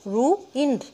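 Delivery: "ruined" broken as ru, ind; split syllables. Shown as a demonstration. Teacher shows students two syllables, "ruined" split. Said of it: "इसमें दो हैं".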